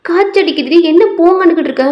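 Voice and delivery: exasperated acted mother; worried scolding. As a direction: scold the sick daughter in alarm. Mother scolding feverish daughter.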